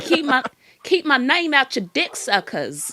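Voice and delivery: loud, high mimicked outburst; Deity. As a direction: belt it out as a mock telling-off. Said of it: "do an impression of Deity".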